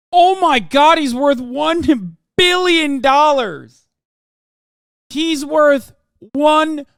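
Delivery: loud, high, stunned disbelief; shocked shouting. Real, not acted. Shocked at Buffett's net worth.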